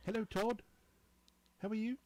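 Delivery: imitating a British engineer, pitched high; mock British greeting, high and lilting; British accent. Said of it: "he's British".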